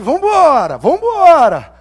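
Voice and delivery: God's call to move, voiced loudly; rallying call. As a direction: urge them on to go.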